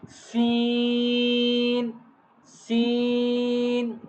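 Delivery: "Seen" stretched on a level note; letter-name demonstration. Shown as a demonstration. Teacher modelling the letter for pupils, sustained "Seen". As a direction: hold the long ee in "Seen".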